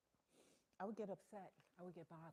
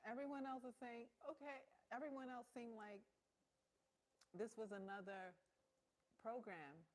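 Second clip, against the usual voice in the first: mock indifference; 6 semitones higher, 6 dB louder than usual. Mocking others again.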